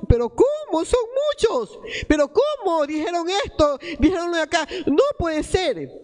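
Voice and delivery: high-pitched, incredulous protest; acted disbelief. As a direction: protest in alarm as if it cannot be true. Acting out disbelieving denial under trial.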